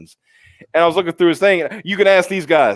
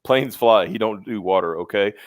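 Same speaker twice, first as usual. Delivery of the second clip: usual speaking voice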